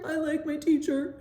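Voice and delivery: mother imitating her son's tearful answer; little boy voice. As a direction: voice the small son answering.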